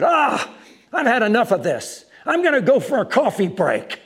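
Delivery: loud, high mock exasperation; fed-up heckler. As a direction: imitate a man storming off fed up. Retold heckler storms off fed up.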